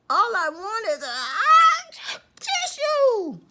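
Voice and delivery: loud high wind-up into a sneeze; building sneeze. Acted. Sick wolf asking for a tissue, sneeze building.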